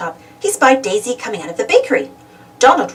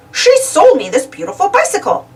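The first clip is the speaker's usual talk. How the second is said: cheery shopkeeper; 9 semitones higher than usual. A narrator's reading. Bicycle shopkeeper answering Donald's question, cheery. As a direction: chirp it like a helpful clerk.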